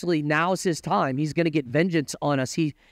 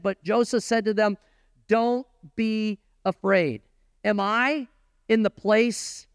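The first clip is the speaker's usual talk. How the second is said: slow, raised reading voice; scripture reading; 5 semitones higher, slower than usual. Acted. Preacher reading Genesis to the congregation, slow and raised. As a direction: lift the verse slowly off the page. Reads the scripture passage aloud.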